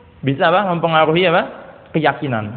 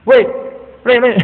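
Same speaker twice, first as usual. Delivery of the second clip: put-on loud, higher shout of woi at a child; rough calling out; 6 semitones higher, 3 dB louder than usual. Parent rudely hollering at a child, mock yell. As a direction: yell at the kid like a rude parent. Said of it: "Jangan manggil anak".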